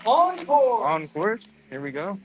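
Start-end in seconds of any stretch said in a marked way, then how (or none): 0.1-0.8 high, loud excited call. Real, not acted. Announcing rider start on livestream.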